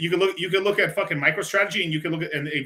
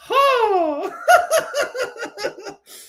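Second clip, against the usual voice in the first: loud high-pitched laughter; a loud, high burst of laughter; 6 dB louder than usual. Real, not acted. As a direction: crack up at your own joke. Laughing at own story.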